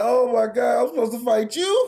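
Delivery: loud, high mock wail; mock crying. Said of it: "He was the crying".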